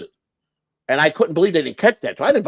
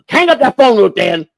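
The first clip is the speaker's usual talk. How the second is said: higher, loud command; ordering colonel; 6 semitones higher, 3 dB louder than usual. Retold or imitated. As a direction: bark the order across the room.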